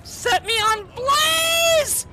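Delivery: high, loud mock invitation; sarcastic yell. Real, not acted. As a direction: shout it in fed-up mock cheer. Sarcastic yelling as character burns.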